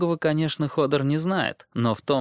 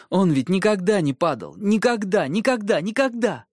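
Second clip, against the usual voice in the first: put-on insistent boy; 9 semitones higher than usual. Bran insists repeatedly.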